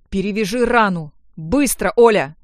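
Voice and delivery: loud, urgent command; exclaimed order. A narrator's reading. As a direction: order it done at once. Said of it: "воскликнул он".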